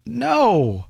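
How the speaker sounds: a sharp, high, scornful "No."; emphatic no. Real, not acted. Host answering his own question about Democrats, scornful no. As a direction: shoot the idea down in one word.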